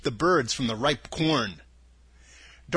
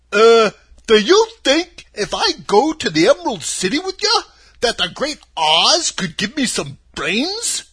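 loud, high, husky scarecrow; scarecrow character; 6 semitones higher, 8 dB louder than usual. Acted. Scarecrow asking to go see Oz, husky and hopeful. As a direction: ask hopefully in a big, swooping voice.

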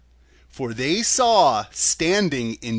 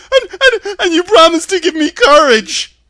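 very high, loud stammering lion; panicky, high-pitched cowardly beast; 13 dB louder than usual. A narrator's reading. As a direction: yelp it out with a nervous stutter. Cowardly Lion voiced in story.

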